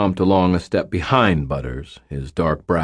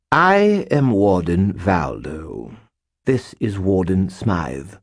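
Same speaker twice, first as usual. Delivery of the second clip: slow, formal snooty British introduction; haughty British warden bowing; slow stately British accent; slow British nobleman; 2 semitones higher, slower than usual. A narrator's reading. Butters' nobleman voice.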